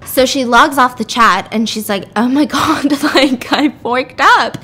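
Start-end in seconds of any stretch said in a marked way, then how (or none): 2.2-4.6 mock-upset wail with a laugh, drawn out; retold dismay, loud and stretched; half-laughing "what have I done". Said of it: "she's really upset by this".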